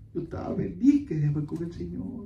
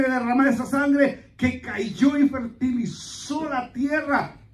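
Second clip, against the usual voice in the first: loud, high, ringing proclamation; full-force preaching; 8 semitones higher, 6 dB louder than usual. Real, not acted. Pastor proclaims the resurrection to the congregation, preaching at full force. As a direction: drive each phrase out to the congregation.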